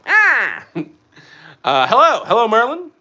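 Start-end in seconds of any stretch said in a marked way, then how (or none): 0.1-0.6 high mock "ah" cry; Danhausen. Streamer mimicking a wrestler after laughing at himself, high cry. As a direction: imitate a comic high-pitched "ah". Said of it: "Why did I sound like Danhausen?".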